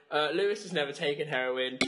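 ordinary talk

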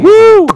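sudden loud, high-pitched woo; whoop. Real, not acted. Sudden whoop during banter.

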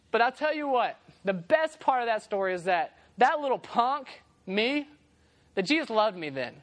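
usual speaking voice

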